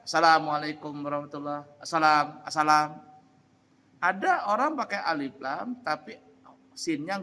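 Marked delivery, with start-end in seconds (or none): 0.1-3.0 "assalam" with a short single s; wrong single s. Shown as a demonstration. Teacher showing the forbidden reading with a short s. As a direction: say "asalam" quickly, s not doubled. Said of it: "tidak boleh dibaca".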